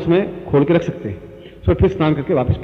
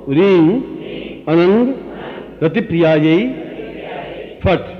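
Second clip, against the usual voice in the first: call and repeat; 3 semitones higher, much slower than usual. Shown as a demonstration. Guru leading disciples to repeat the mantra word by word. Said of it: "अब आप मेरे सामने उच्चारण करें".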